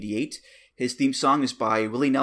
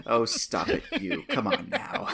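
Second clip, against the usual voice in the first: playful mock protest; laughing, tease him back; gentle teasing. Playful reply to oldness jab.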